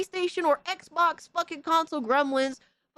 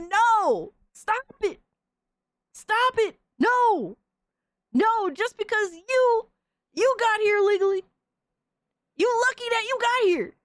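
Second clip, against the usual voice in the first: higher, louder mock outrage; mock outraged yell; 8 semitones higher, 4 dB louder than usual. Streamer yelling at the convert to stop: mock outraged shouting. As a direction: shout him down, half joking.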